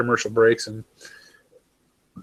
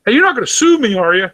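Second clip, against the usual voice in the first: much higher and louder teasing joker voice; mock Kiwi teasing; 10 semitones higher, 6 dB louder than usual. Kiwis joking about Americans suing, high and loud mock voice. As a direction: tease him like a cheeky local. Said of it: "That's the joke".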